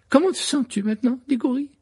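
high, concerned question; nurse voice. A narrator's reading. Madame Pomfrey asking Cédric how he feels, high. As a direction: check on the patient.

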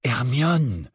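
unhurried, level calling of a name; slow, deliberate. A narrator's reading. Harry slowly drawing Hermione's attention, deliberate. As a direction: say the name slowly, as realisation dawns. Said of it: "dit lentement Harry".

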